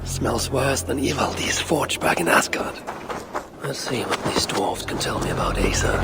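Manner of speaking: hushed inner-monologue whisper; whispered thoughts. Acted. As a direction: breathe it low to yourself, plotting.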